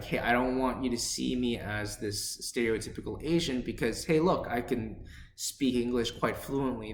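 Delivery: ordinary delivery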